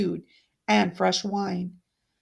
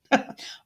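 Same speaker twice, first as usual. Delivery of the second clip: short cruel laugh. Acted. Vicious smile at the ghost.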